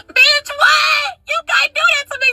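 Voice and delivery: high-pitched mock wail; imitated tearful outcry. Protesting to the man who manipulated her, high mock crying. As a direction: cry out in hammed-up distress. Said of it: "So, bitch, I'm crying".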